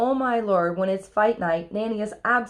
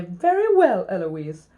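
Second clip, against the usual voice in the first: cheeky copycat concession; mimicking the tutor; 4 dB louder, slower than usual. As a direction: parrot it back with a swoop up. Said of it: "I stand up and say".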